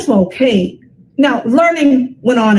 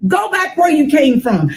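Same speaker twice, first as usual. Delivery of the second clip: jeering crowd; 7 semitones higher than usual. Acted. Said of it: "we heard people in the street saying".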